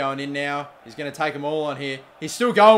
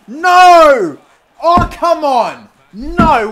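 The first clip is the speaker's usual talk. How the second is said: loud, much higher, slow despairing cry; anguished shout; 11 semitones higher, 17 dB louder, much slower than usual. Real, not acted. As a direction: howl as the chance is missed. Shot missed, anguish.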